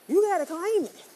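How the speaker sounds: loud, insistent push; emphatic shout. Real, not acted. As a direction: belt it out like a rallying cry. Urging listeners to claim birthright.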